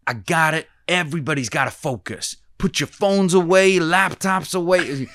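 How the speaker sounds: a loud, hammed-up American accent giving orders; terrible American accent. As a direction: boss the room like a boot-camp instructor with a bad American accent. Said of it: "my terrible American accent".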